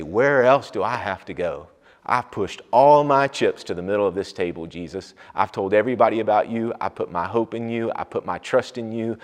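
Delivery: loud, high, driving sermon voice; fervent preaching. Acted. Pastor preaching to his church, loud and fervent. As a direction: preach it with full force to the congregation.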